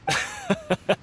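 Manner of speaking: a very high proud laugh; boastful laugh. Acted. Fighter laughing proudly at being asked, boastful laugh. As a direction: burst out laughing, full of yourself.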